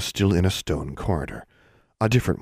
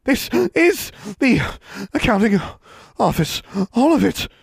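dazed witch; 4 dB louder than usual. Acted. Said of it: "The witch, dazed".

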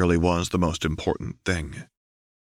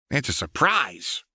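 higher sly playful voice; coy and teasing; Jinx voice; 6 semitones higher than usual. A narrator's reading.

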